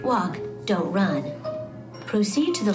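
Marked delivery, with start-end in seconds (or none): none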